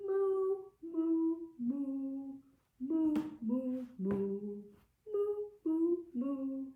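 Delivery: sustained, sung moos; playful cow mooing; cow noises. Shown as a demonstration. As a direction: moo along in a singsong. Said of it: "Let's do some barn animal noises".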